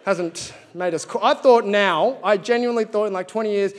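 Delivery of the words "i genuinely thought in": normal talking voice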